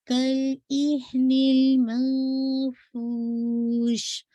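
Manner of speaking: كَالْإِهْنِ الْمَنْفُوشِ recited slowly, ف breathy; slow melodic recitation. Shown as a demonstration. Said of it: "یہ ہمس بھی ہے تو واضح کیجیے گا".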